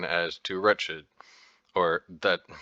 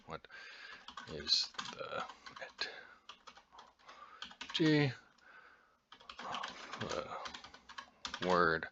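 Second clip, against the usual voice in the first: muttered while typing; 14 dB quieter than usual. Real, not acted. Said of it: "you go".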